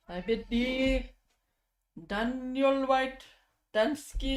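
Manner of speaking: dub a knight with mock pomp; mock ceremonial.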